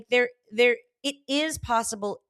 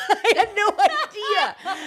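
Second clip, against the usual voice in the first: laughing; 12 semitones higher, 6 dB louder, much slower than usual. Real, not acted. Laughing at own confusion.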